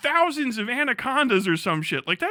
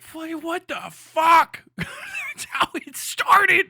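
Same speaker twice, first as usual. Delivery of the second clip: high, slow laugh-choked yell; laughing outburst; 6 semitones higher, 4 dB louder, much slower than usual. Real, not acted. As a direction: crack up while saying it. Laughing at the absurdity.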